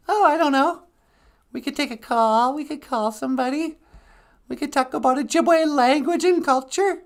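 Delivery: very high-pitched, chirpy character voice; high-voiced sidekick. Sidekick suggesting show topics to host, high character voice. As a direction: pipe up in a tiny high voice.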